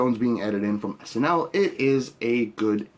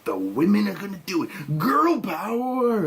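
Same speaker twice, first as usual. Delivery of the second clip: mocking slogan voice. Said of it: "be like".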